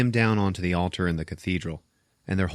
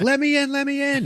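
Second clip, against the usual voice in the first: high-pitched, hammed-up begging; frantic mock pleading; 4 dB louder than usual. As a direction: play chasing a car, pleading to get in. Jenny begging beside moving car.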